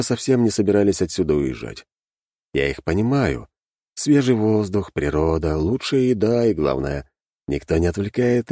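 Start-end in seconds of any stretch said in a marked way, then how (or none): none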